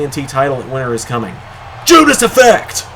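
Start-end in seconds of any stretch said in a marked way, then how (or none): 1.8-2.9 high, slow hype shout; shouted call. Real, not acted. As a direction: yell the move name. Finisher called live.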